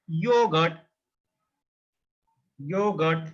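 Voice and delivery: "yogurt" with long first syllable from y. Shown as a demonstration. Teacher repeats the J word for learners, J as Y. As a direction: say "yogurt" in two parts, YO-gurt. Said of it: "J pronounced as yo".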